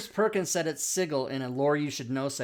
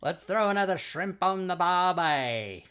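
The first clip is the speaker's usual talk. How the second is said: over-exaggerated Australian accent, slow; drawn-out mock Aussie movie quote; hammed-up Australian accent; Jim Carrey; slower than usual. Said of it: "over-exaggeration by Jim Carrey".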